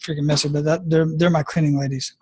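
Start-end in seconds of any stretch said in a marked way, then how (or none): none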